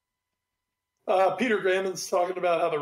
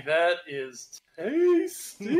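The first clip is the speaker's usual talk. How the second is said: drawn-out slow, relishing "tasty"; savouring; much slower than usual. Real, not acted. Host savouring a juicy matchup, slow and relishing. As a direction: roll the words like a treat.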